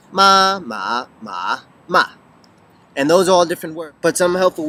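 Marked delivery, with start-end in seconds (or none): 0.1-2.1 "Ma, ma, ma, ma" run through flat, rising, dipping, falling; walk through all four tones of "ma" one after another; four tones in a row. Shown as a demonstration. Drilling the four Chinese tones, each "ma" in its own tone. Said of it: "there are four tones".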